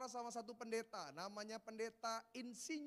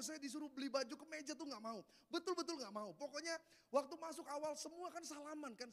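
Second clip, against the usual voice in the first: usual speaking voice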